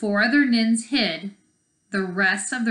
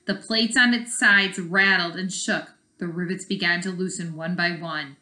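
ordinary delivery

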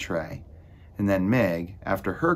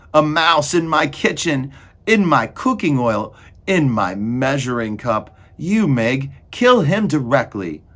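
loud, raised, indignant rant; outraged shouting; 6 semitones higher, 8 dB louder than usual. A narrator's reading. Cook finds a mouse in her oil, shouting furiously. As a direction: shout in outrage, ending in an order.

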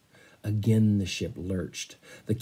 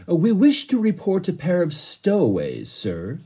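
polite butler; 8 semitones higher than usual. Acted. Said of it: "said the butler".

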